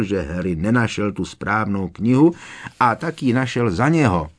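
usual speaking voice

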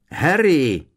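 loud, high cry of a name; calling out. A narrator's reading. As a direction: shout to a friend behind a wall. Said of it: "zavolal znovu Ron".

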